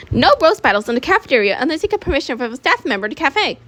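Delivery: put-on authority voice; scolding officer.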